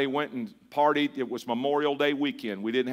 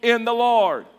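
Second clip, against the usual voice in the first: slow unison recitation; recited together; 8 semitones higher, 6 dB louder, slower than usual. Shown as a demonstration. Preacher leading the church in the verse, slow recitation. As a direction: lead the congregation through the verse. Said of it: "Say it one more time".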